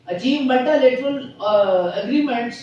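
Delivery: each word spelled out slowly for notes; slow dictation. Acted. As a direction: read it slowly so students can write. (धीमे-धीमे लिखवाना)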